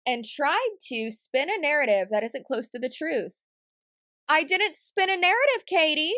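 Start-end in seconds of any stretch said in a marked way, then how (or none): 4.3-6.1 calling Katie out, higher and louder; raised voice. Real, not acted. Answering Katie's accusation with a higher, louder retort. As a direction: push back hard on "I didn't spin a narrative". Arguing back at a critic.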